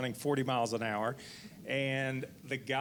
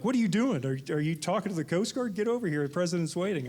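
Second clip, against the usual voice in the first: urgent mock call; 5 semitones higher, 4 dB louder, faster than usual. Friend urgently calling on phone.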